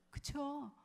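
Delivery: ordinary talk, nothing marked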